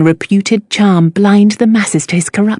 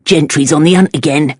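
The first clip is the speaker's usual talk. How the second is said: proud Cockney; 3 semitones lower than usual. A narrator's reading. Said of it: "with a note of something like pride".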